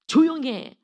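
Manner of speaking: loud, high hushing shout; scornful rebuke. Acted. Crowd silences the despised blind beggar, scornfully rebuking. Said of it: "천대 무시하는 거잖아요".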